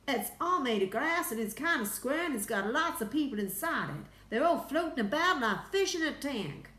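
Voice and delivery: high, loud astronaut voice; excited report. A narrator's reading. Astronaut describing the glass craft to Houston, excited.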